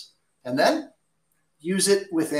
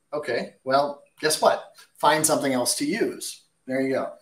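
ordinary delivery

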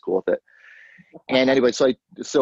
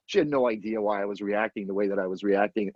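ordinary talk

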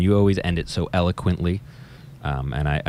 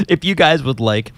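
trying not to laugh; 7 semitones higher than usual. Real, not acted. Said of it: "I'm trying to be professional".

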